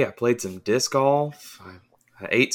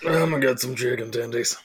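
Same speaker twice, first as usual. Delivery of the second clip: hammy voice; 4 semitones higher than usual. Pretend. Hammed voice of himself.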